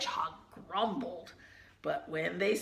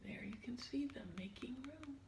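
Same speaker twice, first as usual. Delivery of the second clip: whisper; 17 dB quieter, faster than usual. Real, not acted.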